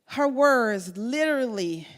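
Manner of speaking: ordinary talk, nothing marked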